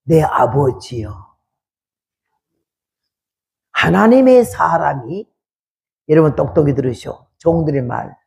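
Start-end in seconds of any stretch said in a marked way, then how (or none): 0.0-5.3 low, slow, humble servant's plea; a low slow hint of pleading servants; servants' plea. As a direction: beg the master gently and slowly. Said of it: "따라온 종들이 이렇게 말했어요".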